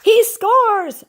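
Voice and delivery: high, loud victory shout. Acted. SpongeBob cheering his own trash-can shot, shouting. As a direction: yell it like you just scored the winning goal. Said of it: "shouted SpongeBob".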